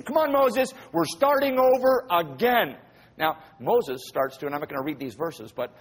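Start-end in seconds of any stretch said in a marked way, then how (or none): 0.0-2.8 urging. Acted. God urging Moses in retelling.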